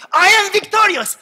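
high, loud scream of triumph; screaming victory. Shown as a demonstration. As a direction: scream it at the walls. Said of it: "start screaming".

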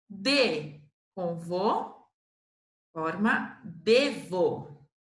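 very slow, syllables spelled out; slow syllable joining. Shown as a demonstration. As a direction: build the word piece by piece. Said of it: "syllable joining demo".

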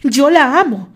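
full furious outburst; exploding. A narrator's reading. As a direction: let it all explode. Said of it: "Richard no soportó más y estalló".

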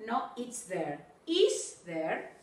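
usual speaking voice

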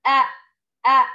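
أ short open glottal a with fatha; model hamza sound. Shown as a demonstration. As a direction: demonstrate أ as a clean short glottal stop plus a. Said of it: "كيف ينطق؟". (نطق الهمزة المفتوحة أ بوضوح)

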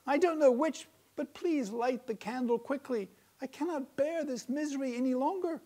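high, loud, pleading wife voice; distressed wife. A narrator's reading. Wife begging husband to light the candle, distressed. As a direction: she can't stand it, begging.

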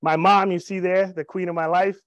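ordinary delivery

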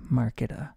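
"marketa" as market-uh; mock Spanish ending. Shown as a demonstration. Teacher has class say market, "marketa" with added "uh". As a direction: add "uh" to the end of "marketa". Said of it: "For market, you say".

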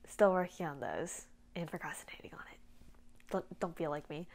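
very soft, near-whispered confession; sheepish aside. Real, not acted. Admitting own procrastination to viewers, hushed sheepish aside. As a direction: drop it right down, almost to yourself.

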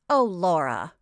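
loud, exasperated reproach; fed up with a sister; seriously annoyed. A narrator's reading. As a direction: let the annoyance drop onto the name. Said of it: "Josie began to be seriously annoyed".

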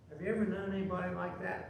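ordinary talk, nothing marked

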